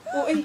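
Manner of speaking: high fake yelp; mock cry of pain. Pretend yelp of pain for the child. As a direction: squeal like it hurts.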